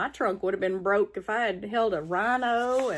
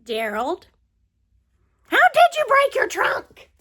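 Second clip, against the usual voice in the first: high, excited pig voice; Piggy voice; 6 dB louder than usual. Acted. Piggy asks Gerald about his trunk, high piggy voice. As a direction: squeal it out as Piggy.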